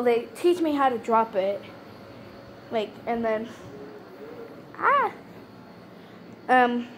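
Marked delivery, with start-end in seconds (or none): 4.8-5.1 sudden high "ah!" of surprise; startled yelp. Real, not acted. Sees her sugar glider suddenly, a high startled yelp. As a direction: yelp as the pet pops out.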